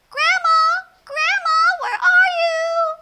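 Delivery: put-on a girl calling through the house for grandma; little girl calling. Little girl calls for grandma at the door.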